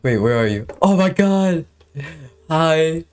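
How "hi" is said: usual speaking voice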